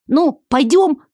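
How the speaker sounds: high, pushy little creature; impatient creature voice. A narrator's reading. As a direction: hurry her along impatiently. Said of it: "нетерпеливо поторопил".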